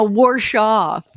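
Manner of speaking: slow "wash" with an added r, "warsh"; drawn-out hillbilly "warsh" for "wash"; hillbilly pronunciation. Retold or imitated. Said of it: "they like to say".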